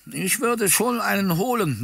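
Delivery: put-on high, frail old man's mumble; mumbling old man. A narrator's reading. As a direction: mumble it like a feeble old gentleman. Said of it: "nuschelte der gebrechliche alte Herr".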